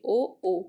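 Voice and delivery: ओ sounded out loud and round from throat and lips; sound demo. Shown as a demonstration. Teacher demonstrating the throat-lip sound, loud ओ. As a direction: voice each ओ clearly from the throat through rounded lips. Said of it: "ओ मतलब कंठोष्ठ".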